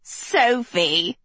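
high, mocking cackle on a name; cackled. A narrator's reading. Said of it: "the second cackled".

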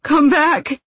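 very high, loud call; last frantic wail; desperate shout. A narrator's reading. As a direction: cry out after those who left. Wounded man calls after the Shu.

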